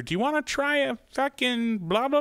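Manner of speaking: high mocking sales pitch; pushy sampler. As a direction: squeal it like an overeager sample lady.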